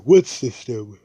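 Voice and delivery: loud, high mock indignation; incredulous question. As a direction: imitate a royal blurting out a challenge. Snobbish girl interrupts, incredulous.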